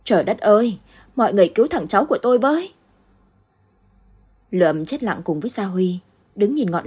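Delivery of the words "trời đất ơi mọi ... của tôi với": loud, fast, frightened cry; terrified shout. Acted. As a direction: blurt it out in panic. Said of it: "sợ hãi la lớn lên".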